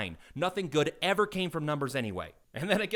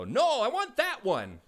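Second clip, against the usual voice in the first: put-on high, slow insistent kid voice; child correcting the clerk, slow and loud; impatient kid; 9 semitones higher, 5 dB louder, slower than usual. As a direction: whine it like a fed-up child. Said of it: "they go".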